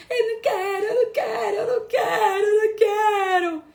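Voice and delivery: put-on high, loud whiny little child's wail; whiny toddler refusal. Mother imitating her toddler's crying refusal at breakfast.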